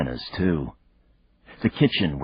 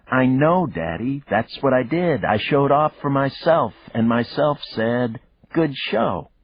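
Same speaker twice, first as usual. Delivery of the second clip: put-on high, cheeky child voice; a kid showing off; little girl voice; 9 semitones higher, 5 dB louder than usual. A narrator's reading. Daughter's voice on phone.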